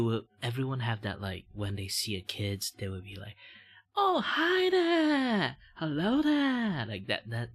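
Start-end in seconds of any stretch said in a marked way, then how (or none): 4.0-6.8 put-on very high, slow baby-talk greeting; sing-song hello for a toddler; talking to a kid. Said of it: "that kind of voice".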